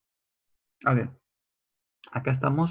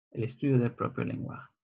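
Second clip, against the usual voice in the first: fast and softer, "El estudio del propio lenguaje" run together; quick soft recap; 5 dB quieter, faster than usual. Shown as a demonstration. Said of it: "Es el estudio del propio lenguaje".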